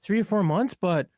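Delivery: a hint of the engineer's voice; quoting the engineer. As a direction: imitate the studio engineer mid-compliment. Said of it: "He said".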